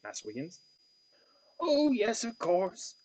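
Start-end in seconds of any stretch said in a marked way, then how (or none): 1.6-2.9 put-on high, loud, touched old woman; a touch of emotion, Cornish lilt; moved half tone. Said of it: "answers Nellie in a half tone, obviously moved".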